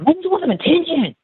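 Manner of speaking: very high mocking imitation; dismissive teacher's assistant. Assistant dismissing the crying kids, high mocking voice.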